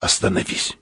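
urgent whispered command; sudden whisper. A narrator's reading. Explorer suddenly orders the pilot to stop, whispering. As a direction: whisper it softly, without voice. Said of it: "вдруг сказал шёпотом".

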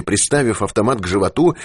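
plain reading voice

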